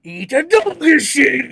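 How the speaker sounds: slow, loud, high ranting shout; hammed-up anime-style yell; Japanese Alex Jones. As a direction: bellow each word like an anime ranter. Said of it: "Japanese Alex Jones all the time".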